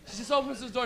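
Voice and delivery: ordinary talk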